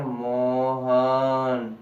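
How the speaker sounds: Mohan stretched very slowly, calling someone far off. Shown as a demonstration. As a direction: call out Mohan, holding the last vowel. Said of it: "तो ऐसे ही हम क्या करेंगे". (दूर से मोहन को लंबा पुकारना)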